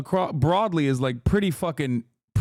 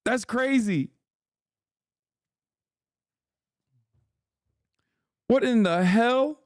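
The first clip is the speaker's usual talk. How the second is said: higher, drawn-out disbelief; stunned; 8 semitones higher, slower than usual. Real, not acted. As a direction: let it sink in, appalled. Incredulous at chat.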